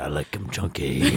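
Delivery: slow, soft mock husky voice; imitated Gooch voice. Podcast blaring as someone walks in, slow husky imitation. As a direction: lightly mimic the slow creepy croon. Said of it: "And it's like".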